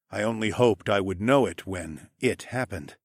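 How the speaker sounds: usual speaking voice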